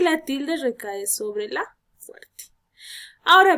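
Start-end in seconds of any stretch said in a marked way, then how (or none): 1.5-2.4 whispered ending. Real, not acted. Teacher drops voice at end.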